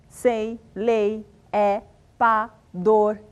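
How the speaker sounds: "sei, lei, é, pá, dor" with a firm stressed beat; stressed monosyllables. Shown as a demonstration. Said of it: "Por exemplo, de tônicos". (monossílabos tônicos com ênfase forte)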